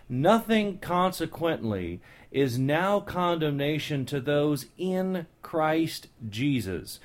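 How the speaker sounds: "Nothing consequently is now condemnation to those in Christ Jesus" read slowly, word by word; slow, deliberate reading. Shown as a demonstration. The preacher reads the verse to his listeners slowly and deliberately. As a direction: let each word land. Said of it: "I want you to look at this".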